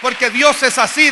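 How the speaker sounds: loud, rising on así; loud proclamation. Real, not acted. Preacher praises God before the crowd, loud rising. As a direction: announce it with joy. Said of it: "dígalo".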